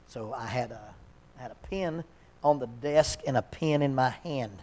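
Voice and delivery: usual speaking voice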